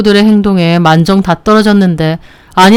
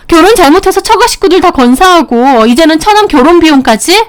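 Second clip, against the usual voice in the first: higher, heated voices of brother-in-law, wife and mother; family quarrel voices; 7 semitones higher than usual. Acted. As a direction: act out the bickering family, raised pitch. (높은 톤으로 다투는 가족 목소리)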